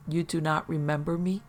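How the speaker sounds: ordinary delivery, nothing marked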